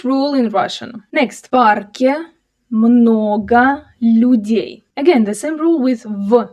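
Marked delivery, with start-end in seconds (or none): 1.5-4.8 slow, drawn-out model reading for learners; slower reading. Shown as a demonstration. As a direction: stretch each word out for a learner to follow.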